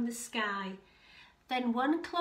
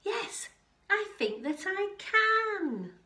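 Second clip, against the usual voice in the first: high, drawn-out, triumphant reply; joyful yes; 9 semitones higher, 5 dB louder than usual. A narrator's reading. Kevin agreeing at last to play, joyful. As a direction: build to a long, proud finish.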